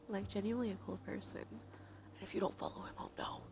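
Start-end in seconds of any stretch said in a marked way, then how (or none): none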